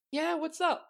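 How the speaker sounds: very high, casual character voice; high-pitched, easygoing reply; Seeker's reply. Acted. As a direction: answer brightly in a high put-on voice. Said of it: "you hear a voice saying".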